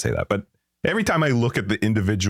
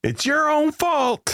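mocking; much slower than usual. Mocking the bear defender.

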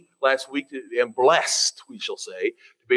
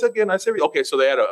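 ordinary delivery

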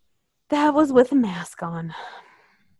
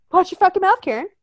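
proud replay; 9 semitones higher, faster than usual. Retold or imitated. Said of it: "nice dig in on Karen".

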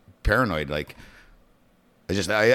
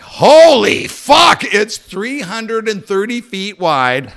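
high, loud, slow shout of alarm; stunned yell at the blaze; 11 semitones higher, 9 dB louder, much slower than usual. Retold or imitated. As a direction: bellow it as the fire comes into view. Sees the huge fire, shouting.

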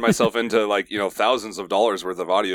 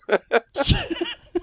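high burst of laughter; big laugh. Real, not acted. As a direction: laugh out at the punchline. Laughing at own nickname story.

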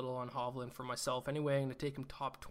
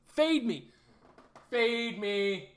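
emphatic shout; 8 semitones higher, 6 dB louder, much slower than usual. Real, not acted. Urging viewers to bet against him.

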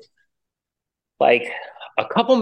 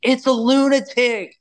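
high, put-on woman's voice; a startled lady, voiced much higher; mock lady's voice; 3 dB louder than usual. Lady on the couch warning her household, high mock voice.

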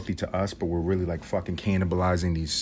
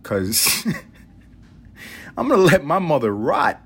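joking; 6 semitones higher, 4 dB louder, slower than usual. Pretend. Joking line with laugh.

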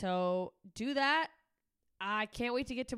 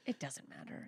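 whispered aside; 6 semitones lower, 9 dB quieter than usual. Acted. Mock-dismissing the app.

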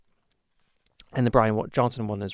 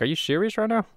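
pitched way up in disbelief; incredulous reply; 9 semitones higher than usual. Retold or imitated. YouTuber quoting his reply to a rude commenter, high mock disbelief. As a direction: retell your own stunned comment, voice jumping high.

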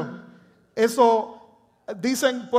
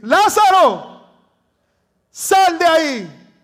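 slow, high, full-voiced shout; Jesus shouting for Lazarus to come out of the tomb; loud exclamation; 6 semitones higher, 8 dB louder, much slower than usual. Shown as a demonstration. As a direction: cry it out as a command to the dead. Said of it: "porque está en exclamación".

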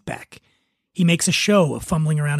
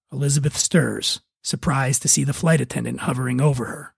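normal talking voice

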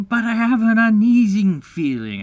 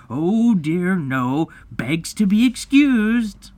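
dangerously sarcastic; 4 dB louder than usual. A narrator's reading. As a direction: pile on bitter, exaggerated sarcasm. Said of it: "He became dangerously sarcastic".

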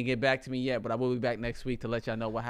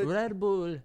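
put-on Jamaican accent; 9 semitones higher, 3 dB louder than usual. Echoes in the accent.

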